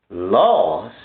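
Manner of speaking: low, flat echo, rising at the end; deadpan disbelief. Acted. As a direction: repeat it like it's absurd. Echoing answer in disbelief.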